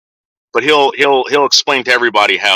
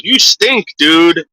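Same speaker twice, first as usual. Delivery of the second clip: high, teasing you stink; mock dad teasing; 7 semitones higher, much slower than usual. Dad teasing the guests after farting, high and mocking. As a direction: tease in a raised voice. Father's joke after fart.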